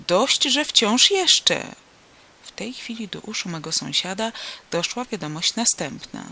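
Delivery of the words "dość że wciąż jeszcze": high, loud, very slow exclamation; insistent call. A narrator's reading. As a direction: call it out high, stretching every word. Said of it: "zawołał sąsiad". (wysokie, głośne, przeciągłe wołanie)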